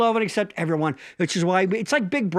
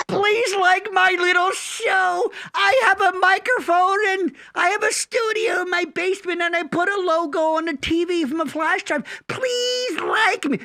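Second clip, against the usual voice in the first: high-pitched mock-pleading whine; hammed-up needy plea, stretched on the pleases; needy begging; 9 semitones higher than usual. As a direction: beg for approval like a desperate little host. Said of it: "Needy as me?".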